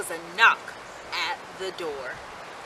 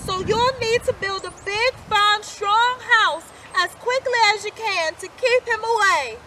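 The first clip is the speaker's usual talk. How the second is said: high, louder mother's warning; 9 semitones higher, 4 dB louder than usual. A narrator's reading. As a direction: urge them to build strong. Mother warns departing pigs.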